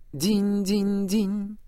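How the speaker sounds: high slow bell chime; chiming ding-ding. Acted. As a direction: ring it out like a bell. Girl repeats the chime.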